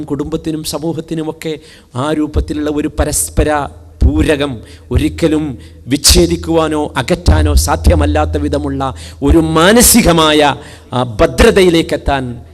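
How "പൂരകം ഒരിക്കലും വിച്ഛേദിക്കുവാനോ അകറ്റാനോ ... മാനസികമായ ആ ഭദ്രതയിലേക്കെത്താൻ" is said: preaching at full force. Acted. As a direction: proclaim the verse to the hall. (ഉച്ചത്തിൽ പ്രസംഗം)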